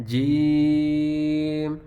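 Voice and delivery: jeem stretched to three beats; long jeem. Shown as a demonstration. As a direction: hold the letter name long and slow. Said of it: "جیم کو لمبا کریں گے تین حرکات تک".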